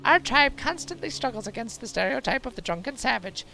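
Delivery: high, put-on old crone voice; old wise woman voice.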